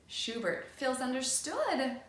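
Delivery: usual speaking voice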